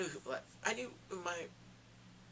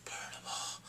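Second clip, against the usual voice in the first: sinister whisper; hushed menacing whisper; 6 dB quieter than usual. Retold or imitated. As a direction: breathe it like a dark secret. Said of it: "I was like".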